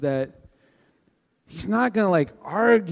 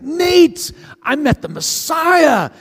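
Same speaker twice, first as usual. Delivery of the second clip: much higher and loud, slow on the Messiah; thrilled announcement; 13 semitones higher, 9 dB louder, slower than usual. Retold or imitated. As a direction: blurt it out to your buddy, overjoyed. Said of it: "And he's so excited".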